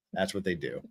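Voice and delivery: ordinary delivery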